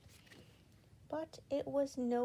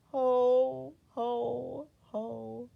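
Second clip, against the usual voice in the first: mournful; 3 semitones higher, 6 dB louder, much slower than usual. A narrator's reading. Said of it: "she added mournfully".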